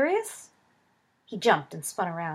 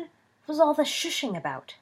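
demanding; 9 semitones higher, 5 dB louder than usual. Acted. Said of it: "he demanded".

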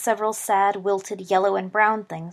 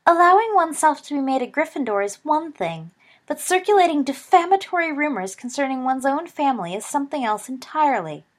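high, louder, sneery posh mimicry; snooty mother's voice; 7 semitones higher, 5 dB louder than usual. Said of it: "he put on a high-pitched, snooty, sneery voice".

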